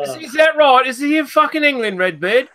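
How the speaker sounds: hammed-up posh Londoner, sneering; lightly done upper-class English voice, mocking; mock posh English accent. Taunting the Scottish friend in a mock posh English accent.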